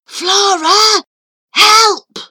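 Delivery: urgent hushed yell, high and loud; whisper yelling. A narrator's reading. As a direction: yell under your breath for help. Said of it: "Charlie whisper shouted".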